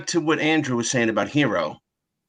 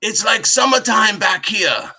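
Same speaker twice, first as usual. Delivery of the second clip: put-on mild, slow broken Japanese accent; imitating Hero's broken English, drawn out; 8 semitones higher than usual. Imitating Hero telling the driver about the heat in Japanese accent. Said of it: "broken Japanese accent".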